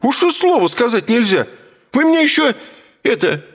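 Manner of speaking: high, loud outraged woman; indignant yelling woman. A narrator's reading. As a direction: yell in offended fury. (возмущённая кричащая женщина)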